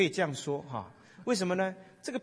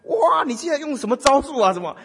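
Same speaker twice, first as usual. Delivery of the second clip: high, loud mocking jeer; loud sarcasm; 11 semitones higher, 9 dB louder than usual. Wife taunting her silent husband at the table, loud sarcastic jeer. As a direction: sneer at him loudly, as a taunt. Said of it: "就讽刺更大声".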